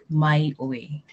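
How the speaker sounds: slow "mai oi", "mai" up and "oi" down; showing the question tune; rise then fall. Shown as a demonstration. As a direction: lift "mai", drop to "oi". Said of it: "goes up, and then it comes down".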